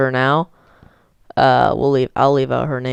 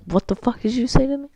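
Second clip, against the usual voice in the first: high, fast, softer mocking imitation; mock bus driver; 8 semitones higher, 5 dB quieter, faster than usual. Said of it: "the bus driver was like".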